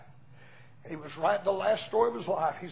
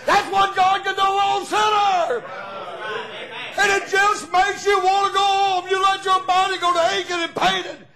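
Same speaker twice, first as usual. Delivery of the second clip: full-force preaching cry; 10 semitones higher, 7 dB louder than usual. Acted. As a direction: cry it out to the congregation.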